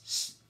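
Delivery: s said alone as a hissed s; the s sound. Shown as a demonstration. Teacher showing pupils the sound, a drawn-out s. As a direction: give the bare s sound, held. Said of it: "entonces suena s".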